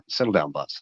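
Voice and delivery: low aside to the dog; hushing the dog. Real, not acted. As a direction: quietly settle the dog down. Hushing his crying dog.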